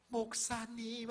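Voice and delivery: high, drawn-out coaxing call; seductress. Acted. Courtesan calling to the pastor she means to tempt, seductive. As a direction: stretch it out coyly to lure him. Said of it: "이런 분위기를 연출한 거예요".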